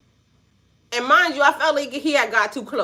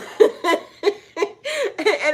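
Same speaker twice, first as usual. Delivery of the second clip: long, high-pitched laughter; real laugh; 10 semitones higher than usual. Real, not acted. Laughing about her son's view of her, high laugh.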